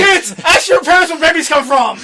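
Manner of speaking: loud, laughing movie line; laughing quote. Retold or imitated. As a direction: quote it while cracking up. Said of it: "I seen that".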